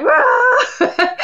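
high, loud mock roar; excited "Rah". Fan telling of rushing to the microphone, excited roar. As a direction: burst out with a whooping "Rah!".

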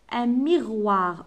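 Un miroir drawn out; slow model word. Shown as a demonstration. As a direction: stretch Un miroir to show roi. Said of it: "on a roi". (Un miroir ببطء)

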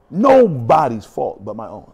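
ordinary delivery

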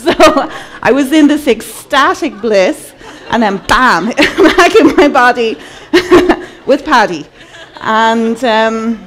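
bursting into laughter at the punchline; laughing. Real, not acted. Telling the audience about Paddy while laughing.

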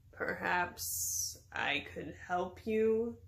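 very slow, halting, low offer; reluctant. A narrator's reading. Wizard offering help with flowers, reluctant. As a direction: drag it out unwillingly. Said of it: "he said reluctantly".

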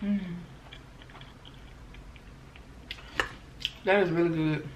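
talking while chewing pancakes; words muffled by food; mouth full, chewing. Real, not acted. Seen 2: Bite taken at -7; closed-mouth chewing continues through the labelled words.

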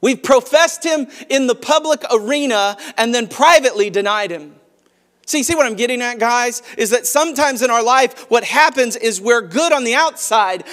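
high, loud, driving sermon climax; preaching at full force. Acted. Pastor urging the church, preaching loud and high. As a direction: press the point home to the congregation.

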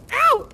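high, loud "ow"; sudden sharp cry at a smashed finger; yelp of pain. Acted.

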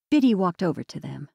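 ordinary delivery

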